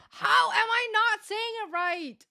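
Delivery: high, slow, drawn-out whine; frustrated high complaint; exasperated whine. Real, not acted. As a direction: plead in disbelief.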